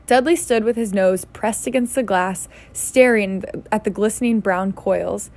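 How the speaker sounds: usual speaking voice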